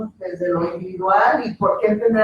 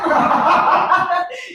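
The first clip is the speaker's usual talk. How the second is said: laughter; 9 semitones higher, 4 dB louder than usual. Real, not acted. Laughs at joke sign.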